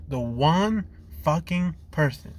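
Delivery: usual speaking voice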